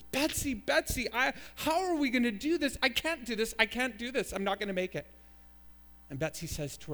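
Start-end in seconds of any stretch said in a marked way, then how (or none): 0.0-5.1 high, panicky plea to a sister; hammed-up despair, pitched high; acted desperate shout. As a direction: cry out as if unable to cope. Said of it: "Corrie shouts out to Betsy".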